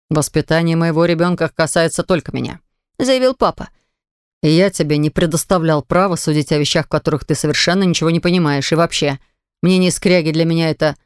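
a hint of a father reasoning gently; lightly voiced patient father. A narrator's reading. Father explaining to his son on his knees, low and patient.

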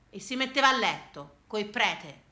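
ordinary talk, nothing marked

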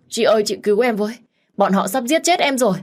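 high, loud desperate cry for help; wailing plea. A narrator's reading. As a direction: cry out in terror to big sister. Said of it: "đang gào khóc thảm thiết". (gào khóc cầu cứu)

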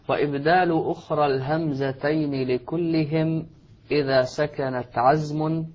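slow, measured chanting of a poem; verse recitation. Acted. Shaykh reading the verse from the screen in slow chant. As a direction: intone the line slowly, savouring each word.

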